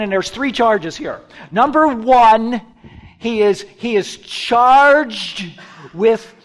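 loud, high, driving scripture reading; forceful preaching. Real, not acted. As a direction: proclaim the accusation with rising urgency. Preacher reads accusation at full force.

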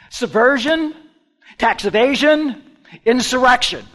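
loud, high, punched list; proclaimed charges. Retold or imitated. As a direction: ring out each charge separately.

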